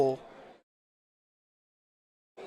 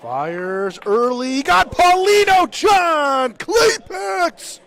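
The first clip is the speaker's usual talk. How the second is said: drawn-out shout climbing very high; climactic shouted call; 12 semitones higher, 11 dB louder, much slower than usual. Real, not acted. Commentator as John Claypax hits Paulino, wildly excited shout. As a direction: erupt into a slow, high-pitched yell.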